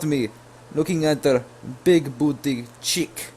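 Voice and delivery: loud, high hammed-up Russian accent; mock Russian accent. Replying to the girl as a fake old Russian man, hammed-up accent. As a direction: play the sixty-six-year-old Russian man. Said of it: "continue with the whole Russian thing".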